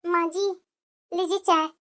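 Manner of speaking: daughter-in-law quietly obeying her scolding mother-in-law, softly; meek daughter-in-law. Acted. Bahu serving tea meekly.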